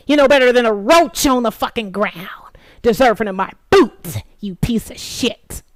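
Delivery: hissed insult, loud but breathy; venomous whispered scorn; contemptuous whisper. Real, not acted. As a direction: spit the words in a fierce whisper.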